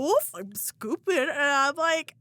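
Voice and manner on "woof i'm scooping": slow, higher, louder goofy mock voice of the ex. Boyfriend teasing while groping her, goofy mock voice. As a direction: ham up his dumb joke.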